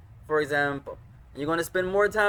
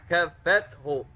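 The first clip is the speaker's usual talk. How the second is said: ordinary delivery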